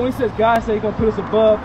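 ordinary delivery

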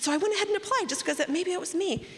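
high, fast anxious explaining; rushed pleading. Retold or imitated. Explaining to the judge, fast and anxious. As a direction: rush out the excuse nervously. Retold eager plea to judge.

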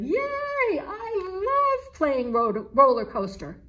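high, loud, delighted yay; excited shout. A narrator's reading. Meeser cheering at playing his favorite game, excited shout. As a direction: cheer with pure kitten joy. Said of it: "Meeser shouted".

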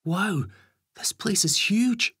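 put-on very high, drawn-out child's voice; high-pitched wonder; awestruck child. A narrator's reading. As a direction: marvel slowly like an amazed little boy. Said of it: "They look around in awe".

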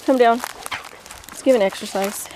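ordinary delivery

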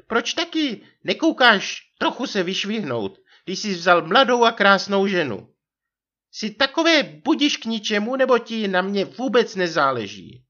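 very high, loud nagging wife voice; vyčítavá manželka. A narrator's reading. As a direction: reproach him in a put-on high wife's voice. (vysoký vyčítavý hlas manželky)

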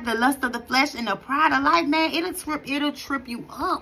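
usual speaking voice

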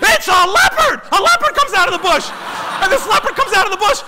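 high, loud announcement of a shock arrival; hammed-up thrilled shouting; excited shout. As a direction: yell it as a comic climax. Climax: a leopard appears.

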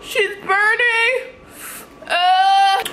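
high, drawn-out wail at the burn; burning yelp. Real, not acted. Vlogger reacting to a burning sensation, high wail. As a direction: wail it high, stretching the vowels.

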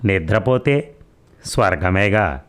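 usual speaking voice

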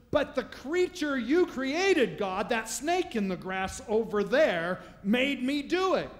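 high, loud, slow accusing plea; indignant Eve. Acted. Eve to God blaming the snake, loud high protest. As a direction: point at the snake in outrage.

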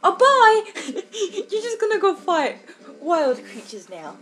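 high, loud, laughing mock parent; mock parents waving goodbye. Parents sending their ten-year-old off, mock cheery. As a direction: cheerfully send the kid off to fight, laughing. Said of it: "your parents are just like".

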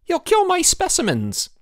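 high, loud alarmed cry; alarmed shout. A narrator's reading. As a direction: yell in panic.

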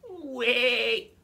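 high, loud urgent goat. Acted. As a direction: squeal it to stop the troll. Middle goat pleads.

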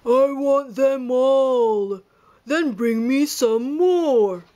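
slow, drawn-out greedy voice; greedy whale. A narrator's reading. Whale demands all the donuts, slow and greedy. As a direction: savour every greedy word. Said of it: "a whale".

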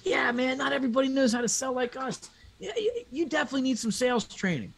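high, cocky sales rep voice; smug salesman. Retold or imitated. Sales rep talking down to Kyle on the phone, high and smug.

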